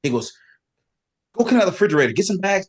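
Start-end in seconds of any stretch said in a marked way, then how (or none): 1.4-2.7 higher, louder imitation of Lee Priest's accent. Lee Priest's accent, ordering Melvin to empty the fridge, loud. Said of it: "Lee Priest in his accent".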